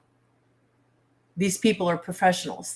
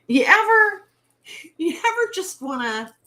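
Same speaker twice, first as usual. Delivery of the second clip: exasperated; 11 semitones higher, 5 dB louder than usual. Real, not acted. Rising exasperated question.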